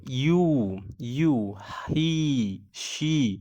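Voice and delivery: "he" and "she" with long drawn-out vowels. Shown as a demonstration. Teacher shows students a long vowel in "he". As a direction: stretch the vowel in "ইউ" out. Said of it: "টেনে পড়তে হবে".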